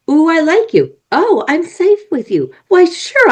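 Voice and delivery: high, delighted playful voice; happy hormones voice. Pretend. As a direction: coo like a cheerful little hormone.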